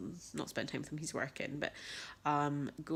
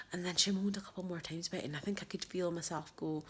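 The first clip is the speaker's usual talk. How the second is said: normal talking voice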